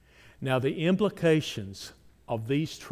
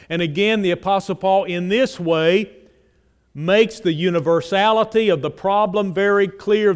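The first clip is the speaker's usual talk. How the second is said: loud, raised preaching voice; forceful preaching; 4 semitones higher, 10 dB louder than usual. Acted. Preacher booming Romans to the congregation, loud and raised. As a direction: drive the verses home from the pulpit.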